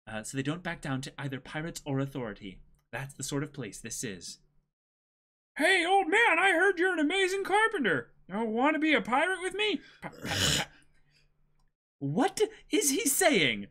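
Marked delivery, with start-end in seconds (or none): 5.6-13.7 put-on high, loud carefree boy; cheeky young pirate calling out; brash boy hero. S0 voices Luffy reading dialogue.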